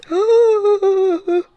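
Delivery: sharp high gasp of delight; loud shocked gasp. Real, not acted. Reacting to book banter.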